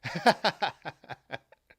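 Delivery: loud high burst of real laughter; laughing out loud at a chat joke; big laugh. Real, not acted. Streamer reading chat, bursting into loud laughter.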